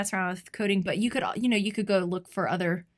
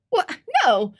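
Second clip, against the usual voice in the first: excited; 10 semitones higher, 6 dB louder, faster than usual. Retold or imitated. She quotes her eager reply.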